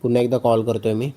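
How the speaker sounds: ordinary talk, nothing marked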